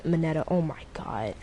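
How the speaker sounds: soft unvoiced whisper. Real, not acted. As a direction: breathe it out, exasperated. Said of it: "whisper heard".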